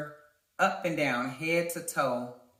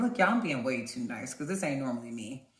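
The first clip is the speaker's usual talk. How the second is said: usual speaking voice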